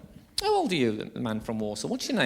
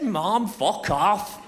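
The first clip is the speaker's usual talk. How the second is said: slow deadpan; 3 semitones higher, much slower than usual. Retold or imitated. Deadpan reply to mum.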